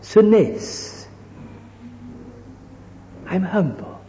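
soft, sugary falsely meek voice; mock humble pose. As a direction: sink into a hushed show of piety. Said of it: "you hold yourself all like this".

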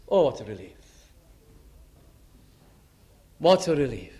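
soft relief after the anxious wait; relieved sigh. Retold or imitated. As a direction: let the worry drain out. Waiting person sees him arrive.

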